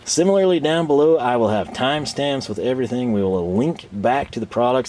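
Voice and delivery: ordinary talk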